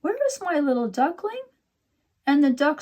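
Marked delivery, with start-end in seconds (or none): 0.0-1.4 put-on much higher little girl calling. A narrator's reading. Little girl calls out for her lost duckling, high. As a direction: call out like a child looking for her pet. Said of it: "the little girl called out".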